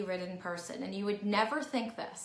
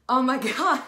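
loud laughing oh my God; laughing exclaim; 5 semitones higher, 13 dB louder than usual. Retold or imitated. To Cindy, who follows her on Instagram, loud laughing delight. Surprised by a fan's compliment.

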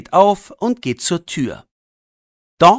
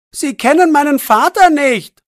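high and loud call after him; 9 semitones higher, 4 dB louder than usual. A narrator's reading. Boy calling after the departing man, loud. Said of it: "ruft Jannik ihm nach".

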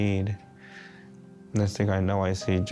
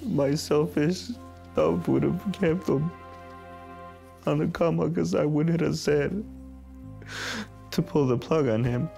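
fresh grief; 7 semitones higher than usual. Real, not acted. Father recalls hospital decision.

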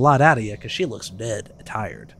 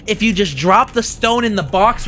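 normal talking voice

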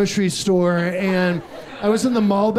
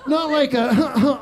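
strained, slow choking voice; 6 semitones higher, much slower than usual. Retold or imitated. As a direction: choke out the words. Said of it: "started choking on a hard-boiled egg".

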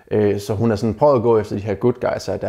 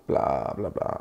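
dismissive bla bla; 9 semitones lower, 8 dB quieter, slower than usual. Acted. Dismissing boring chat.

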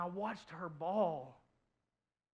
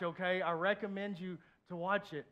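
usual speaking voice

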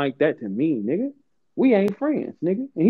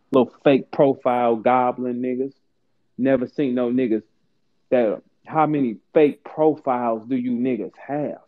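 ordinary delivery

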